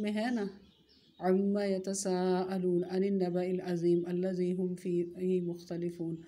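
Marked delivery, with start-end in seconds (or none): 1.2-6.2 chanted Arabic verse; Quranic recitation. Acted. Teacher recites the verse to show where the word appears.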